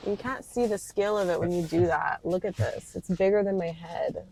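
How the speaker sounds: usual speaking voice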